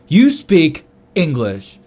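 slow, loud, stunned accusation; incredulous. Retold or imitated. Confronting the coworker who hid his English, slowly incredulous. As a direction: spell out the disbelief word by word.

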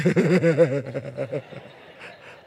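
loud burst of laughter; big laugh. Real, not acted. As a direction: laugh out hard at the memory. Storyteller laughs at the memory.

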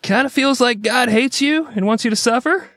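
high-pitched joke doctor voice; put-on high voice delivering grim news; hammed-up doctor quote; mock doctor. Doctor voiced giving grim diagnosis.

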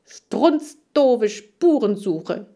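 loud, very slow cursing; loud scolding. A narrator's reading. Hamster cursing the search to get his brain going. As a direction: spit out each insult. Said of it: "zeterte ich".

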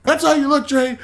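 high, mock-scolding voice; scolding dad. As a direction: scold like a dad telling off a child. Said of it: "like a dad getting after a fucking child".

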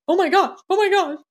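husband panicking when his wife starts crying; imitating husband's panic. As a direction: play the flustered husband, alarmed and climbing. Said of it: "the first few times he's like".

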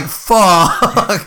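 long drawn-out groan of a curse; comic defeated moan; mock defeat. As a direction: imitate giving up, half laughing. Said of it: "he looked defeated".

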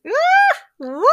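very high, loud squeal then whoop; excited squeal and whoop. Retold or imitated. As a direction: whoop with shrieked excitement, re-enacted. Re-enacting own excited reaction.

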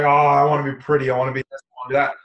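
movie heroine longing to be pretty, mock whiny; imitating the movie heroine. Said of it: "she's like".

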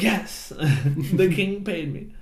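lightly voiced cat owning up, half laughing; meek Latin-accented cat. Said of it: "I kept trying to do a Latin accent".

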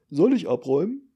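loud, high service question; waiter's voice. Acted. Waiter intruding on the silent three, loud and high. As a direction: ask brightly, breaking the silence.

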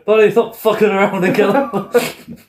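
hammed-up villain barking from the car; mock gangster telling him to finish it; impatient gangster. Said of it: "Raven says".

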